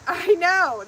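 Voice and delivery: high, very loud excited cry; real excited exclamation; eager agreement. Real, not acted. Eager to meet the gift giver.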